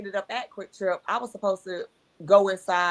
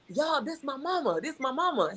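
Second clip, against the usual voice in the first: higher-pitched, softly voiced mock announcement; imitating ecstatic boy; 7 semitones higher, 5 dB quieter than usual. Boy introducing his new mama to restaurant staff, mildly imitated ecstasy. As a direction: lightly mimic a boy proudly showing off. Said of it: "he was so ecstatic".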